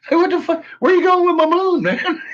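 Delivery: high, fast, loud alarmed cry; hammed-up outrage over the moon; mock panic. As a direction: play a man chasing his runaway moon. Voicing Lee chasing his moon.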